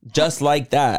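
usual speaking voice

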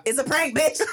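high, mock-triumphant reveal; spring the joke with pretend triumph; gleeful prank reveal. Acts out revealing the faked illness.

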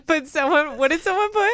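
high laughing voice. Real, not acted. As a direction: squeak through the laughter. Laughing fit.

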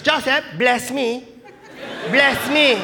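high, slow demanding grandmother; Lola Tinay. Retold or imitated. Grandmother calling back her departing grandson, high slow demand. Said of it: "And she goes".

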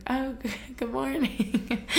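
slow, self-conscious neighborly hello with a nervous laugh; awkward greeting. Retold or imitated. Resident caught in pajamas greets the new neighbors, awkward and giggly. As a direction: act out a sheepish greeting, laughing midway.

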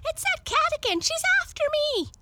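very high, loud and quick, fleeing in fright; scared dog bolting from the cat; panicked dog. Acted. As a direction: yelp it while running away.

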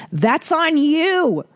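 high, slow, drawn-out put-down; sing-song slow scolding, high; mock scolding. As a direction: wag a finger at the baker. Scolding the British baker.

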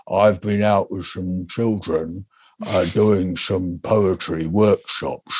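slow, low, sluggish delivery; slow, slurred old talk. Shown as a demonstration. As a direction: drag every word as if thick-tongued. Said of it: "I'll do the way I used to talk now".

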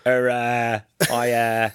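JFK-style Boston drawl, slow; lightly imitating a Boston guy; Boston accent. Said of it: "Why does he sound like J F K?".

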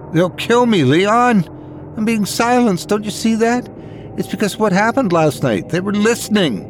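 high, desperate woman's voice; a frightened wife pleading high; panicked young woman. A narrator's reading.

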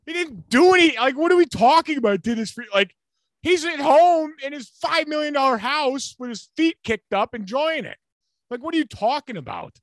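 high, loud exasperated rant; incredulous rant. Real, not acted. Ranting at crying coach quote.

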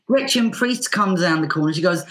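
ordinary talk

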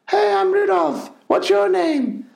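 high, slow put-on reindeer voice; Rudolph's grotty voice. Dad mocking the grotto Rudolph's high voice. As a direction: mimic a cheesy grotto Rudolph. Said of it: "not a massive fan of the voice".

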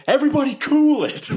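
high-pitched call to a crowd. Acted. Player calls out to panicking goblins.

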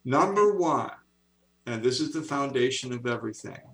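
normal talking voice

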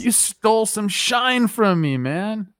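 mocking imitation. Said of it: "You're like".

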